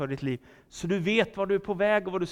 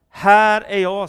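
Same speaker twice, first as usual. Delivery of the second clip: loud, higher answering call; 4 semitones higher, 12 dB louder, slower than usual. Acted. Boy Samuel answers the Lord's call, calling out loudly.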